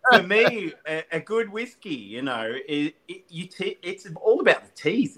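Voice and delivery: carried away by the topic; excited. Real, not acted. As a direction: guest gushing about whisky's chase. Enthusing about whiskey.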